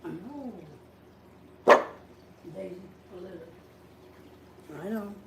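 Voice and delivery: soft, hushed murmur to a pet; calm the sick dog gently, barely voiced; soothing the dogs. Real, not acted. Hushed murmur to the sick dog in the owner's arms.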